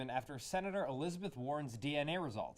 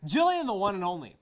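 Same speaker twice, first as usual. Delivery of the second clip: ad pitch; 6 dB louder than usual. Acted. Mock travel ad pitch.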